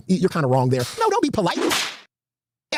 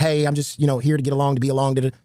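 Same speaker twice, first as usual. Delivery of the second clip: lower, lightly voiced corporate yes-man; a hint of a bland people-pleaser; mock company guy; 8 semitones lower than usual. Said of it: "more like a company guy".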